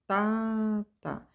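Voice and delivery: accent the first "tá", soften the second; rhythm syllables with a strong first beat. Shown as a demonstration. Teacher chanting the binary beat for students, first tá accented. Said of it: "na linguagem rítmica".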